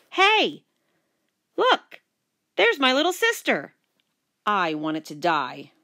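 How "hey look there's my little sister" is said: high, loud taunting holler; loud brotherly call-out, pitched way up. Acted. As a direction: holler it out for everyone to hear. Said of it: "he hollered".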